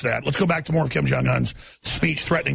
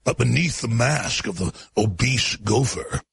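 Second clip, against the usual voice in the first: dramatic slow narration; 7 semitones lower, slower than usual. Acted. Alex Jones dramatic narration.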